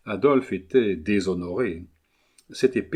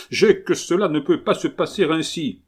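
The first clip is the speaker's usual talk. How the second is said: higher and louder, fully worked up; exasperated outburst; 7 semitones higher, 5 dB louder than usual. A narrator's reading. Collard protests to Leven about his son, exasperated. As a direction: let the anger spill over. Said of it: "s'exaspérant de plus en plus".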